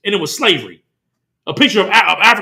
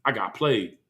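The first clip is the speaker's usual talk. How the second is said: low, soft, deflated Isaac; dismayed mutter; 9 semitones lower, 11 dB quieter, slower than usual. Retold or imitated. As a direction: mutter it as if just realizing you were tricked. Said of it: "He's like".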